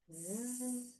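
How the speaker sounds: soft sustained zzz; quiet insect hum; bee buzzing. Pretend. Teacher hints what the bear seeks, soft buzz. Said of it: "the bear is looking for".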